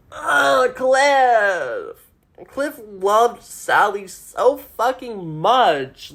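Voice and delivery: slow, high, tearful cry of grief; getting emotional. Real, not acted. Recalling Cliff's love for Sally, really tearful and slow. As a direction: let the grief break through. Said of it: "I'm getting emotional thinking about this".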